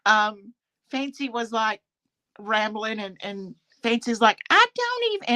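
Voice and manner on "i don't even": high imitated southern drawl. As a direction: mimic her southern twang. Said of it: "in her southern drawl".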